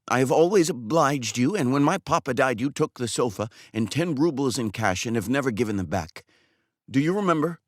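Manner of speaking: put-on a jilted barber reproaching the girl's father; pleading barber Makar. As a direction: pour out his grievance to the man he trusted.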